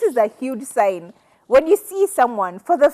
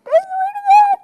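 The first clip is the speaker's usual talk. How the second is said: squeaky, much higher and a bit louder; squealed oath; 4 dB louder than usual. Real, not acted. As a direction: squeal it with delight.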